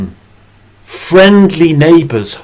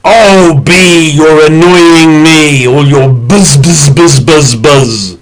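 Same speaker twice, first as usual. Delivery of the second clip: loud, annoyed shout at the bee; angry man; 2 semitones higher, 8 dB louder, slower than usual. Acted. Angry man in the garden yelling at the bee, loud and irritated. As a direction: bellow at the pest buzzing round you. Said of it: "The angry man said".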